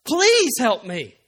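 loud, pleading cry to God; desperate plea. Pretend. Broken sinner crying out to God, pleading loudly. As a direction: cry out as if at the end of your rope.

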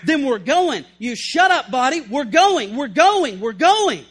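loud, hammed-up drill-it-home order; forceful self-command. Paul ordering his tired body onward, in a loud acted command.

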